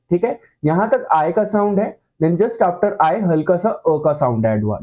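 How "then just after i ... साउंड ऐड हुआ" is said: usual speaking voice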